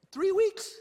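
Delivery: high-pitched, tentative mock question; comically high, unsure ask; tentative request. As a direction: float it up high, as if testing the answer. Retold timid bargaining with official.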